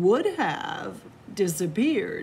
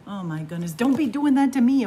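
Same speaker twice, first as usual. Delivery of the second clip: fast louder mock fright; mock startled; 4 semitones higher, 7 dB louder, much faster than usual. Reader pretending the children scared her, fast mock fright. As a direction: pretend they scared you.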